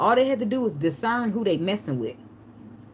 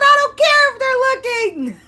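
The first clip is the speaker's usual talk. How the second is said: high, loud defiant yell; defiant shout; 9 dB louder than usual. Retold or imitated. To boyfriend, loud high don't-care shout. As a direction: shout it so the neighbors hear. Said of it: "I said".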